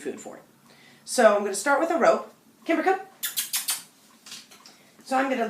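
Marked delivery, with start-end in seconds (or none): 2.6-3.0 calling the dog. Real, not acted.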